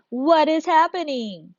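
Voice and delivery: "What is happening" drawn out slowly and pitched high; self-questioning. Shown as a demonstration. Teacher modelling a question to ask oneself, slow high "What is happening". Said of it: "I can ask myself the question".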